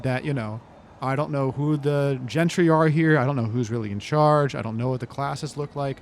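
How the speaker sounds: ordinary talk, nothing marked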